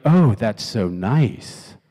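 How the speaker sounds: low, very slow, drawn-out reply; lukewarm, stretched-out politeness; unimpressed response. Acted. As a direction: drag it out as if underwhelmed. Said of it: "or she could be like".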